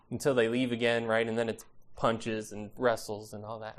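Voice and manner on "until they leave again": normal talking voice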